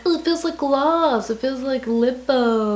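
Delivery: lightly mocking a lip product's marketing; mock ad claim. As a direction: parody a product's cheerful promise. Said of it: "a long wear product that's like".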